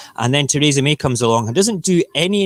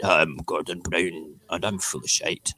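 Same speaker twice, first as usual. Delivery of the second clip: Gordon Brown impression; 5 semitones lower, 7 dB quieter than usual. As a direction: put on a gruff Scottish voice. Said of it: "you can hear it".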